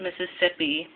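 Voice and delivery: ordinary talk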